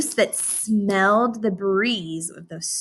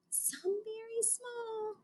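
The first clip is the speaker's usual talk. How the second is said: tiny voice; 14 semitones higher, 20 dB quieter, slower than usual. Shown as a demonstration. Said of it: "tiny voice for small".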